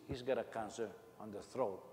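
usual speaking voice

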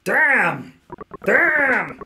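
high, stretched, falling cry; hammed-up cry that slides down; drawn-out exclamation. Game character exclaims at trouble, hammed-up high falling cry.

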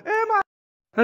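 sharp, very high cry of pain, cut off. Acted. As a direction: yelp as if struck mid-word. Cries out when hit in fight. (તીણી, ખૂબ ઊંચી દર્દની ચીસ)

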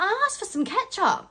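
high-pitched retold protest; mock indignant. Customer explains the dirty look to friend, mock indignant. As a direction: pitch it right up, playfully aggrieved.

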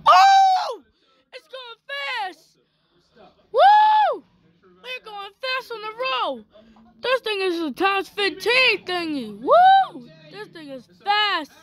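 high excited whoops; whooping thrill ride. Real, not acted. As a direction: yell with the rush of speed. Riding fast, whooping.

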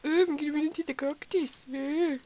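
mock a dim ogre in a badly put-on accent; terrible ogre accent. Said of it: "for those terrible accents".

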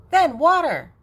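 put-on high and loud, a small child's eager voice. Grandma reading, voicing the eager little girl, high and loud. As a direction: chirp it out like a little kid.

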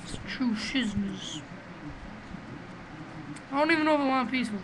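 ordinary talk, nothing marked

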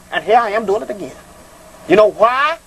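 ordinary talk

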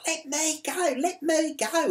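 imitated high, loud struggling cry; mock-hysterical, pitched right up; frantic protest. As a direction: mimic someone being dragged off and protesting. Restrained woman's struggle imitated.

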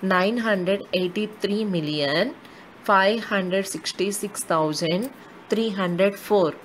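slow, with a pause after each period; a teacher repeating the number slowly, period by period. Shown as a demonstration. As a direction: spell it out group by group. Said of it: "I'll repeat the number name as per the periods".

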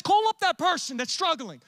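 high, loud pressing command; urgent voice of God. Acted. God urging a believer to call someone, loud urgent command. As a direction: push them to act now. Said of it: "When he says".

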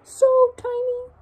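very high squeaky little voice; tiny voice. Shown as a demonstration. Teacher asking kids, squeaks tiny in a high voice. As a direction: make it sound tiny and high. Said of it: "Does that mean so tiny".

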